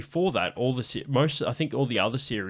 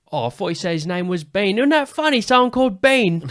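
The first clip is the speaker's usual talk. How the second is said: loud, very high imitation of amazement; mock amused outburst; 10 semitones higher, 9 dB louder than usual. Celebrity riffing on fan's misheard name, hammed-up amazement.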